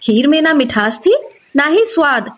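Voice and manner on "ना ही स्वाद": ordinary delivery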